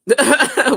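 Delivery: big burst of high laughter; loud laugh. Real, not acted. Host laughs at his own Libra list, loud burst. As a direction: crack up out loud.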